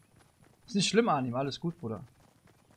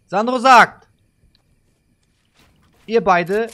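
loud call; 5 semitones higher, 12 dB louder, slower than usual. Real, not acted. Hyped host calls the command.

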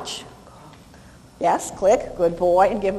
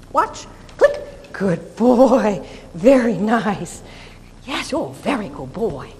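soothing praise; 2 semitones lower, 3 dB louder, slower than usual. Shown as a demonstration. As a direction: praise him gently and slowly. Training dog in demo.

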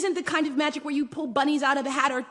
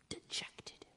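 hushed whisper; 21 dB quieter than usual. A narrator's reading. Daughter sitting sad on floor.

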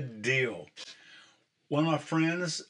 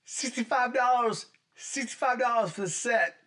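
excited; 8 semitones higher than usual. Real, not acted. Excited about the cheap price.

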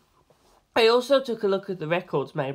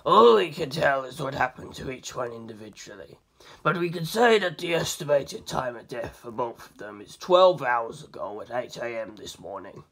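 lower, measured voice; Abaccio, low and steady; 4 semitones lower than usual. A narrator's reading. Abaccio briefing the detective, low and measured. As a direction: keep it calm and grounded. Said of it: "Abaccio said".

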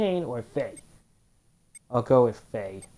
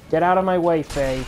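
loud shout, higher pitch; 5 semitones higher, 7 dB louder than usual. Real, not acted. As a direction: yell it out in the fight. Shouting at enemy mid-fight.

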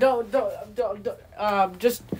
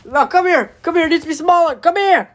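rising, louder come here; insistent call; 5 semitones higher, 4 dB louder, faster than usual. Acted. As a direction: call him over, pushier each time. Chasing with scissors.

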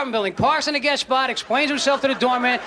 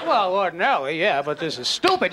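low, dry deadpan reply; a mock-patient explanation, dropped low; filmmakers' deadpan; 7 semitones lower than usual. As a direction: answer low and matter-of-fact. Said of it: "And they go".

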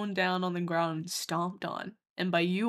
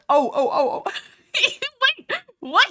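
loud, high laughing outburst; laughing disbelief; 13 semitones higher, 7 dB louder than usual. Real, not acted. Reacting to being misheard, laughing disbelief. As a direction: crack up, then squeal the question. Cracks up at the misunderstanding.

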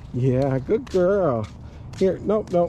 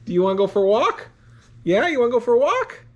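higher, quick and rising to an eager lift; coaxing a dog; 6 semitones higher, faster than usual. Real, not acted. Owner asks dog about a walk, excited pet talk. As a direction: tempt the dog with a walk, pitch climbing.

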